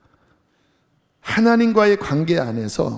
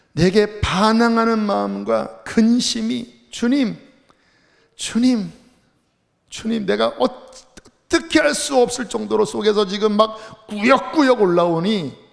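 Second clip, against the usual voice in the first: higher, strained plea to the Lord; welling-up resentment; 5 semitones higher, 3 dB louder than usual. Acted. Preacher voicing Job's complaint to the Lord, resentment welling up. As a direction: plead as bitterness surges up inside. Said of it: "이 원망이 막 속에서 얼마나 막 복받쳐 오르는지".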